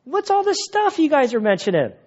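high, breezy "hey guys" voice; casual Jesus. Retold or imitated. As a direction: stroll up and chat like a curious stranger. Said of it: "he's just like".

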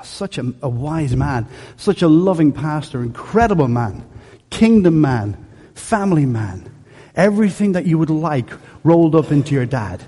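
normal talking voice

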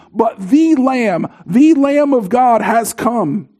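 slow, weighty "the Lamb", leaning on "the"; THE Lamb, not just another lamb; stress on THE Lamb. Acted. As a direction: hit "the" in "the Lamb of God" slowly. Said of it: "Not another lamb has come".

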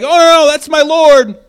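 high, loud protest from Peter; mock defiant Peter. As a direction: throw out a hammed-up defense of the Lord. Imagined Peter defending Jesus.

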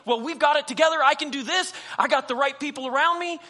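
boastful self-reliance. Retold or imitated. Preacher mocking believers who trust their own strength, loud and cocky. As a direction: brag that you have it handled. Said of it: "we think".

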